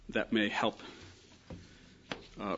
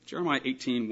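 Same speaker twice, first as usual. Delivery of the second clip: ordinary talk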